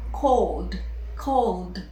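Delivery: "called" with L straight into D; silent E. Shown as a demonstration. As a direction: model "called" in one syllable, D at the end. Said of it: "at the end".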